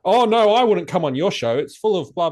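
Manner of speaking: high, loud sneering brush-off; mock dismissive refusal. Quoting a critic who refuses his show, mock sneer. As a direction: mimic a smug critic turning him down.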